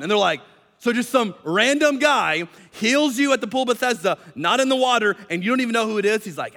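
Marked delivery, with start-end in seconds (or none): 0.8-6.2 high, louder disbelief; mock incredulous. Leaders grill the healed man about a stranger, incredulous. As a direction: pile up the doubts in astonishment.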